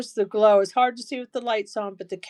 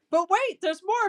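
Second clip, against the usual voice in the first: high, sing-song mock TV pitch; infomercial pitchman; 8 semitones higher than usual. Seller teasing viewers with a higher mock infomercial hook. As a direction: ham up the late-night ad hook.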